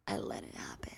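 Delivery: hushed mock confession; whispered admission. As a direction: confess it under your breath. Quoting singer's reluctant admission.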